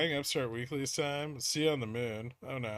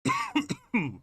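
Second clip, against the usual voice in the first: cough. Real, not acted. Cough mid stream.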